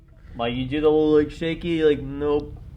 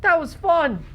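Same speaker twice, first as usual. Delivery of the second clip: tiny high far-off voice; a small creature calling from a distance; high little sprite voice; 3 dB louder than usual. Acted. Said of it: "this little voice saying".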